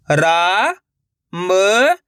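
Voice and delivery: रा, म each held long and rising; letter-by-letter demo. Shown as a demonstration. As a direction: sound out रा and म separately. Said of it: "एक अक्षर का उच्चारण सही से". (रा, म अलग-अलग खींचकर)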